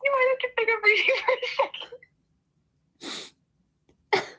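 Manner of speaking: high laughing whimpers of pain; leg cramp pain. Real, not acted. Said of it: "my foot is cramping".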